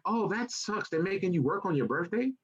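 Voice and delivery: high, airy mock-naive lady voice. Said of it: "She was like".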